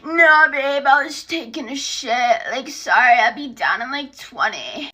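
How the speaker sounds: lightly mocking boyfriend voice; put-on voice making casual excuses; imitating boyfriend. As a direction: do the boyfriend fobbing her off. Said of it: "he's all like".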